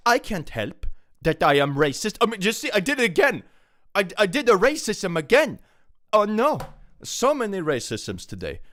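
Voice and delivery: high, loud hammed-up wail of guilt; mock distress. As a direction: pretend to panic at your own terrible slip. Mock confession after racism accusations.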